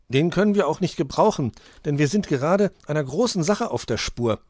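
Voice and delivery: high, loud, self-important boast; Borstel acting important. A narrator's reading. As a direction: show off as a big detective. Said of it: "tat Borstel wichtig".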